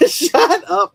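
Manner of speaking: high, loud, through a laugh; real laughter breaking into the words; laughing retort. Real, not acted. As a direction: playfully scold while cracking up. Laughs off someone teasing him.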